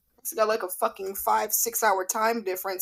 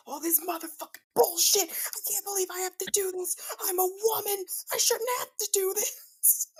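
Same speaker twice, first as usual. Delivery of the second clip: higher, softer whiny complaining; griping; 10 semitones higher, 4 dB quieter than usual. Retold or imitated. Complaining about doing labor, higher whiny griping. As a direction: bitch and moan about the chore. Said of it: "I bitch the entire time".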